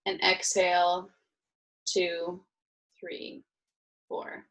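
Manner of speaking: drawn-out counting on an exhale, fading; slow breath count. Shown as a demonstration. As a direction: count slowly while breathing out. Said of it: "So for example".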